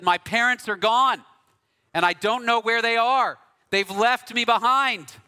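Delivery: a hint of a tearful lost child; lost little girl. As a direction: voice a frightened little girl who lost her parents. Lost little girl voiced in story.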